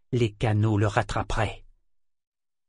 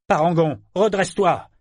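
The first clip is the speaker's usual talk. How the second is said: loud, higher shouted order; bellowed command; 6 semitones higher, 4 dB louder than usual. A narrator's reading. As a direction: bawl it at the ship. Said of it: "brailla-t-il".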